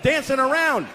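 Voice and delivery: frantic father. Retold or imitated. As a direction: lose it explaining nothing. Father unraveling under questions.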